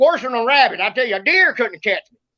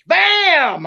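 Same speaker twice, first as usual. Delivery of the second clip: door bang; 7 semitones higher than usual. Retold or imitated. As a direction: mimic a door slam. Kicking the door in.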